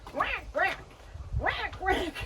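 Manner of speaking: high quacking duck voice. Shown as a demonstration. Teacher quacking like a duck for the kids. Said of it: "Let's fly like a duck".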